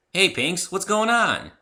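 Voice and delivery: casual tomboy greeting; raspy, boyish voice. Acted. Rainbow Dash greets Pinkie, a touch raspy and boyish. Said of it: "Her voice was raspy and almost boyish".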